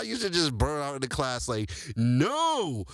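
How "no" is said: higher-pitched mimicked teacher; mock the teacher's comical "no"; funny teacher voice. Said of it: "he had the funniest voice on earth".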